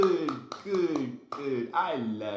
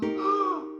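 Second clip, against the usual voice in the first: high excited gasp. Pretend. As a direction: gasp in delight. Excited discovery for toddlers.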